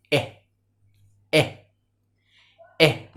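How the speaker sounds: "إيه" as the short E of egg, "eh"; letter sound demo. Shown as a demonstration. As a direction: sound out "إيه" as a brief cut-off vowel. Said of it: "أو الساوند بتاعه إيه؟". (نطق "إيه" كصوت E القصير في egg)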